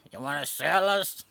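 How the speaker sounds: put-on snail voice, questioning; snail character. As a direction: play a snail challenging its seller. Snail answers the seller, acted.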